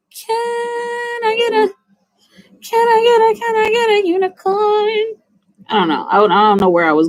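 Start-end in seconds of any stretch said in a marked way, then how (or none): 0.1-5.2 very high, airy unicorn voice; squeaky magical unicorn asking. Pretend. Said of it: "that's my impression of a unicorn".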